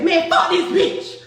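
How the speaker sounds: slow, loud retold outburst; imitating a loud snap. Friend fed up with the fight, slow and loud. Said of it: "Noonie said".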